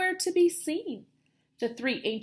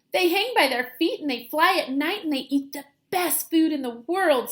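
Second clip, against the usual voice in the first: eager; 4 semitones higher, 6 dB louder than usual. Acted. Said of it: "Stellaluna explained".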